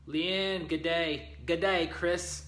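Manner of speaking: a light attempt at an Australian good day; mock Australian accent. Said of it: "I don't have the Australian accent like you do".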